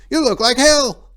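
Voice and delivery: high and loud blunt remark; shocked greeting. A narrator's reading. Jodithus greeting Salinas.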